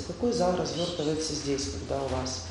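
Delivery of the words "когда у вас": ordinary talk, nothing marked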